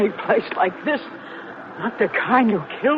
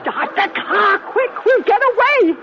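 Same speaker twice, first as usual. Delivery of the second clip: frantic urging; 8 semitones higher than usual. Acted. Panicked passenger urges escape.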